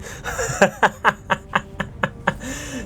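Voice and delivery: high gleeful cackle of triumph; delighted laugh. Real, not acted. Laughing at the explosion.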